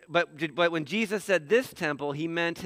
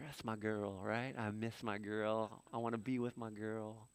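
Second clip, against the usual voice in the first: lovesick longing; 8 semitones lower, 15 dB quieter than usual. Retold or imitated. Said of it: "I would just stare at that picture".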